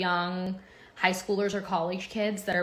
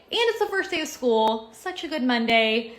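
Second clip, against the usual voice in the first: excited; 5 semitones higher, 6 dB louder than usual. Real, not acted. Excited about first school day.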